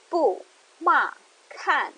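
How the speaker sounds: "bu, ma, kan" each started high and dropped sharply down; fourth tone demo. Shown as a demonstration. Teaching the falling fourth tone on "bu, ma, kan". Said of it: "fourth tone going down".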